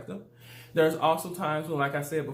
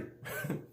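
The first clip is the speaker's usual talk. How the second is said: short laugh; 11 dB quieter than usual. Real, not acted. Laughs after saying "for free.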